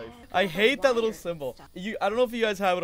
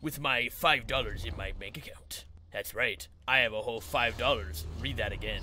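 lower, swaggering fake guru voice; mock Sigma male; 4 semitones lower than usual. Streamer parodying Sigma male posts for chat, lower mock swagger. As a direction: puff up as a ridiculous self-help bro. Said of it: "Sigma male posts".

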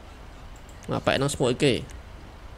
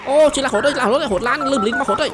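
alarmed outburst; 6 semitones higher than usual. Real, not acted. Player reacting mid-chase in the game, alarmed. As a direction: burst out in alarm, voice jumping up.

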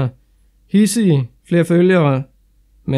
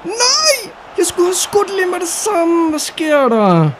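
dismayed outcry; 12 semitones higher, slower than usual. Real, not acted. Gamer groans at missed chance.

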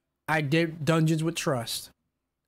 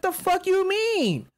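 high, drawn-out indignant challenge; retold incredulous anger; 11 semitones higher, slower than usual. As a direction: challenge him slowly, pitch way up. Retold argument with raid player.

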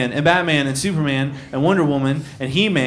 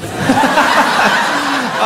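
high, loud burst of laughter; big laugh; 10 semitones higher, 3 dB louder than usual. Real, not acted. Comic cracking up at his cousin's line, high laugh.

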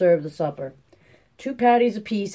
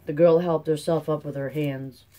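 ordinary delivery

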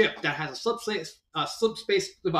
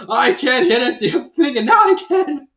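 high, loud hammed-up whine; over-the-top griping shout; mock frustrated yell; 9 semitones higher, 11 dB louder than usual. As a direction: yell the complaint in exasperation. Acts out Church complaining.

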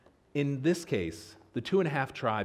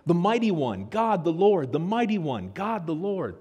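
fervent proclamation; 5 semitones higher, 5 dB louder than usual. Retold or imitated. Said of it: "they say".

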